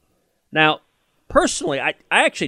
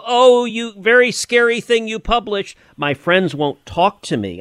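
high, whiny mock sob voice over the first words; mock a crybaby reporter whimpering over scary words; mock weeping reporter; 9 semitones higher than usual. Mocking journalists as crybabies, in a high whiny mock-weeping voice. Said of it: "Woodward and Bernstein weeping".

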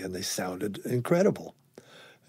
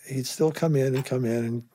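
ordinary talk

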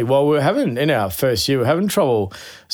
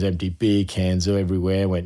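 usual speaking voice

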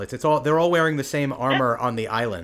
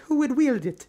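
put-on little girl voice; 13 semitones higher than usual. Little girl asks her mother.

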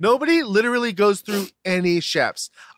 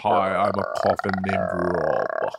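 low creaking coffin voice; cartoon coffin; 10 semitones lower, 3 dB quieter than usual. Co-host playing a haunted house cartoon coffin, creaky. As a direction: groan it out like a coffin door opening. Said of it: "like a, a, a cartoon".

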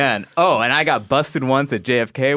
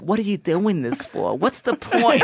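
lightly voiced, raised-pitch old lady asking; 7 semitones higher than usual. Delta agent questioning why he hunts, lightly voiced old lady. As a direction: question him like a puzzled older agent.

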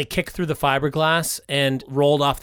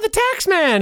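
excited; 13 semitones higher than usual. Acted. Announcing villain role.

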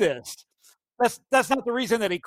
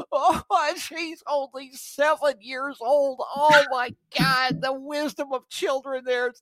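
slow, higher, overwrought mock-tearful voice; mocking Kavanaugh; 7 semitones higher, slower than usual. Host mimics the judge weeping over his daughter, slow and mawkish. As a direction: ham up a choked-up sentimental judge, slowly. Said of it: "and he's like".

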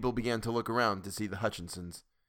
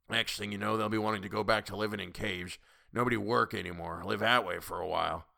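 put-on grumpy old man. A narrator's reading. Old man figure holds the quote.

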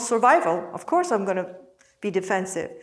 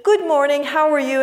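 high, loud, bright sales pitch voice; salesman voice; 5 semitones higher, 5 dB louder, much faster than usual. Retold or imitated. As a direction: play an overeager telemarketer.

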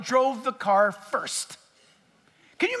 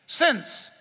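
"Since" alone, firm and falling; stressed since; 2 semitones higher than usual. Shown as a demonstration. Preacher to congregation, insisting on "Since". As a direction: land the single word "Since" hard. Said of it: "It's a statement of fact".

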